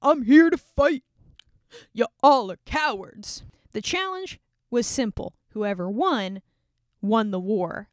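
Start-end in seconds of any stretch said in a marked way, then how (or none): 0.0-3.4 booming mocking giant, higher and louder; loud giant's taunt. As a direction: jeer at them like a giant bully. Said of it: "Goliath would go out and taunt the armies".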